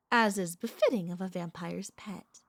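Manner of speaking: formal pronouncement. Acted. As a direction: declare it with a firm opening. Proclaims lover's status, then revises it.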